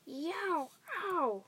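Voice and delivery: high-pitched howls of pain; roared yowls. A narrator's reading. Sleeping giant in a nightmare, roaring in pain. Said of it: "roared the flesh lump eater".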